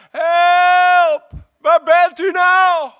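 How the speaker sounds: high, loud panicked shout; frantic yell, high and loud; desperate cry for help. Pretend. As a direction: scream it like you're sinking in the storm. Acted cry in the storm.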